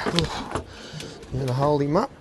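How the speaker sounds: ordinary talk, nothing marked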